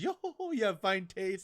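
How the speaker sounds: lightly put-on high character voice; Brook. Brook answering Big Mom, high playful impression. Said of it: "Brook's like, his".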